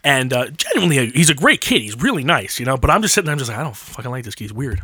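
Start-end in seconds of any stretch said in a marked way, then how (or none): 3.6-4.8 private distrust. Acted. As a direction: say it softly, as if to yourself. Acts out private distrust of newcomer.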